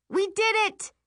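high, loud triumphant cheer; hollering. A narrator's reading. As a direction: holler it with joy. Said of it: "I holler".

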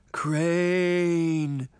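long drawn-out ghostly moan. A narrator's reading. Sabrina posing as a ghost to scare Crane, moaning. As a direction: moan the name like a phantom. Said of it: "moaned in the deepest voice she could produce".

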